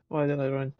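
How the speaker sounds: ordinary delivery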